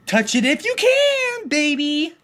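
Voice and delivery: mocking creepy pervert. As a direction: squeak it out like a leering creep. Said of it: "what he does is he's like".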